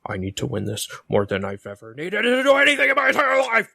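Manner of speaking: mocking Hangman promo. Podcaster imitating an overwrought wrestler's promo, building to a yell. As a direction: build feverishly into an over-the-top wrestler's scream. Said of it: "He's like".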